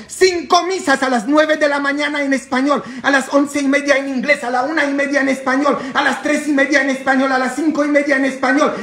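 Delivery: high, loud, rapid-fire schedule; shouted announcement. Real, not acted. Priest inviting everyone to Sunday Masses, shouted announcement. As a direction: call it out high and fast like a crier.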